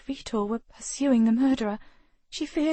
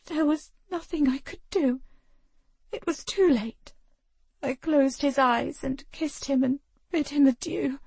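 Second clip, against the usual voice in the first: voice breaking; 6 semitones higher than usual. Acted. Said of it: "Her voice broke again".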